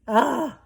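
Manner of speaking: high loud gasp of horror; shocked gasp. A narrator's reading. Homily gasping at Arrietty's words, shocked. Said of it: "gasped Homily".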